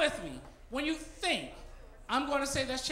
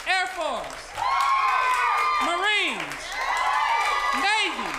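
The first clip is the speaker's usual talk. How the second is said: calling out; 5 semitones higher, 6 dB louder, much slower than usual. Real, not acted. Calling out branches to crowd.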